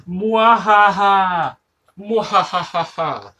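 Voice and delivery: slow, high, booming evil cackle; evil villain laugh. Pretend. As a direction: gloat like a cartoon villain. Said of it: "keep this stream going forever".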